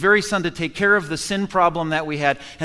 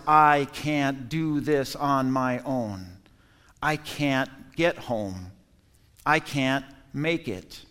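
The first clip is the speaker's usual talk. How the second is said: humble surrender; 5 semitones lower, 7 dB quieter, slower than usual. Acted. Quoted surrender prayer.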